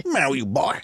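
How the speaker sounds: mock Southern swamp talk; swamp Southern accent. Imitating movie's swamp people, mock Southern accent. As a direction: put on a thick backwoods Southern accent. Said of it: "almost like swamp people Southern accent".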